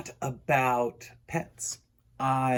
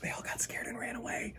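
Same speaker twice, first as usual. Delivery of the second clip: soft unvoiced whisper, much quieter; 8 semitones higher, 19 dB quieter than usual. Real, not acted. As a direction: whisper the aside like a secret. Host confides aside to kids.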